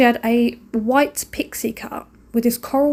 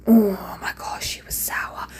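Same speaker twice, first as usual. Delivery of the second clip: hushed, shocked whisper; whispered gossip; 2 semitones lower, slower than usual. Real, not acted. Mum whispering to viewers about the sour old lady, hushed. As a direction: breathe it like scandal. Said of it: "gossiping about sour lady".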